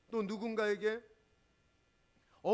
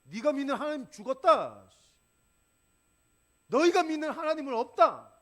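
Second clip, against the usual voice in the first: 높고 크게 고함치는 골리앗; 하나님을 조롱하며 크게 외쳐라; Goliath's shout; 8 semitones higher, 13 dB louder than usual. Retold or imitated. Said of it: "골리앗이 소리를 지르는 겁니다". (골리앗이 이스라엘을 향해 외치는 큰 고함)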